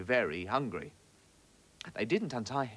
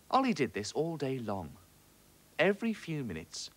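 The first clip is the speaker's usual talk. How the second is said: ordinary delivery, nothing marked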